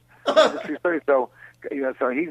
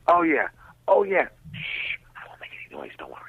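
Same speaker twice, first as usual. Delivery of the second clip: mock whisper; 3 semitones higher than usual. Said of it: "those are the noises".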